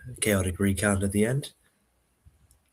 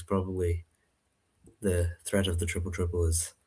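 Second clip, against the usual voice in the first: ordinary talk, nothing marked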